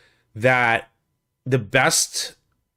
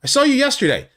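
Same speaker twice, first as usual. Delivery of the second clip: much higher, eager recognition; imitating the writer's sudden delight at spotting a fan; high excited mock recognition; excited retold quote; 11 semitones higher, 4 dB louder than usual. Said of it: "and he goes".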